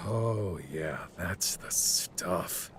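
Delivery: slow, low, whispery contentment; blissful relief. Acted. Soaking in the hot spring, blissfully relieved. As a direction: sigh the words out slowly as you sink in.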